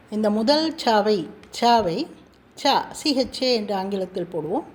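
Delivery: ordinary talk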